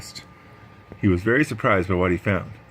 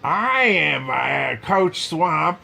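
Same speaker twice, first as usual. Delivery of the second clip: slow, higher tough-coach voice; Coach Swamp's voice; 7 semitones higher, much slower than usual. Acted. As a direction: drag out the name like a menacing coach. Swamp introduces herself as coach.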